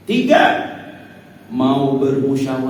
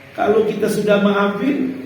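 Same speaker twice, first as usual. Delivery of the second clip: ordinary delivery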